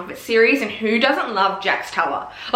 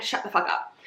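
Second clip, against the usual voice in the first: whispered aside; 7 dB quieter than usual. Acted. Fed-up aside about Elena.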